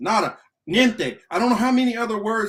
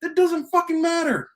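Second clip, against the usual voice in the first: much higher, sharp retort; exasperated retort; 9 semitones higher than usual. Real, not acted. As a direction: snap back in exasperation. Rant rebuttal.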